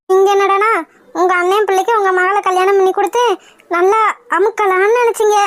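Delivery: normal talking voice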